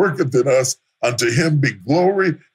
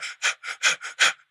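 mock weary sigh; 5 dB quieter than usual. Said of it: "he's like".

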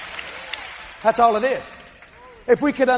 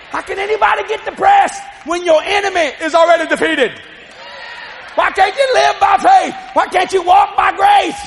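raised, urgent pulpit exhortation; full-force preaching; 6 semitones higher, 4 dB louder than usual. Real, not acted. Preacher urging people to live by faith, fired-up preaching. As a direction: challenge the congregation loudly.

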